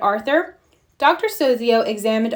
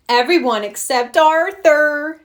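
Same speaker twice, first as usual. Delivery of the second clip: louder, higher mocking shout; shouted taunt; 6 semitones higher, 5 dB louder than usual. A narrator's reading. Francine shouting at Arthur during the movie, mocking. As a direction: yell it to make the class laugh. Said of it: "shouted Francine".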